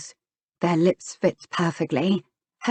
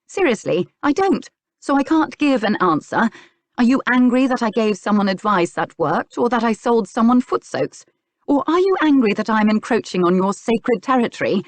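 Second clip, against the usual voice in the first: higher-pitched, exasperated questioning; narrated indignant argument; indignant tirade; 6 semitones higher, 4 dB louder than usual. A narrator's reading. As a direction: fire accusing questions at him. Acted quarrel, she lists accusing questions.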